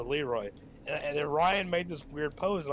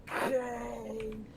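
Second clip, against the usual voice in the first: imitating a clip voice; 7 semitones higher, 4 dB quieter than usual. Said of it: "a clip of the G G one saying".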